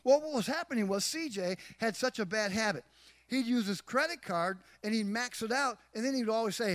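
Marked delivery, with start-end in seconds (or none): none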